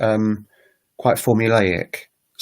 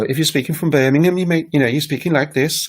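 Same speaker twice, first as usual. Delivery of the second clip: higher, up-and-down Birmingham accent; 6 semitones higher than usual. Shown as a demonstration. Teacher demonstrating a UK regional accent, badly done Birmingham. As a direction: have a rough go at a lilting Birmingham accent. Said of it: "a Birmingham accent, um, will be very up and down".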